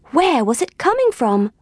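ordinary delivery, nothing marked

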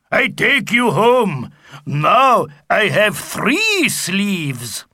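a proud brute announcing his loot; boastful Viking. Acted. Sigurd voiced.

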